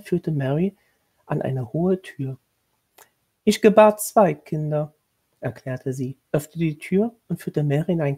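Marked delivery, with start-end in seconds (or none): 3.4-4.9 higher, louder, formal commanding tone; stern lady of the house. A narrator's reading. Lady of the house instructing the new governess, stern and formal. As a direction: lay down the duties like a strict mistress.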